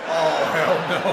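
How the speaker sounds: slow, loud, high, through laughter; laughing disbelief. Real, not acted. Reacting to the Misery joke, slow laughing disbelief. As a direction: drag it out, cracking up.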